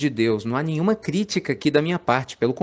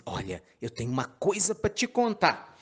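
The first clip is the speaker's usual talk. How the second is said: softer, teasing hush, dangling the news; mock secretive hush; 2 semitones higher, 7 dB quieter than usual. Teasing an anxious friend. (tom baixo e provocador, cheio de suspense)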